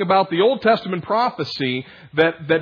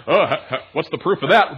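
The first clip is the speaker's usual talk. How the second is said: mocking laugh. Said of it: "You said".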